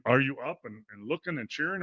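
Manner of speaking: ordinary talk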